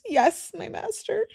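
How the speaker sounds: a high, small yes; mild mock whimper. A narrator's reading. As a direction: whimper it out meekly. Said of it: "Demona whimpers".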